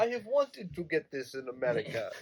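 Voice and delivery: a hint of a mock Russian accent; playful Russian accent, a touch hammed-up. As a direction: put on a thick joking Russian accent. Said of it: "like, a Russian accent".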